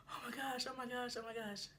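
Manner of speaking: high, small frightened repeating; imitated panic. Cornered girl to her pursuers, mock soft high panic. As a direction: mimic a scared girl under her breath. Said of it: "She is really scared".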